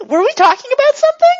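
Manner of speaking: very high, loud, quick; forgetful character chirping a question; oblivious character question. Acted. Character forgets conversation after reset.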